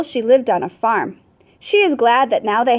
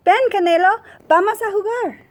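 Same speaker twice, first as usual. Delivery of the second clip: much higher playful call to the dog; child calling dog; 9 semitones higher than usual. A narrator's reading. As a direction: call the puppy out to play like a little girl.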